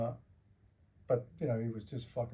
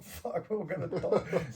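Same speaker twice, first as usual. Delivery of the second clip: slow, laughing mock panic; giggling fake terror; laughing dread; 5 semitones higher, slower than usual. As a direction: laugh through it, half-joking doom. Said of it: "I'm like".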